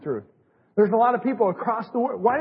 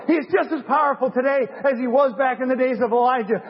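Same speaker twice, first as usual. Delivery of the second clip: raised, ringing revival preaching; preaching at full force; 4 semitones higher than usual. Acted. Preaching climax, repeated calls.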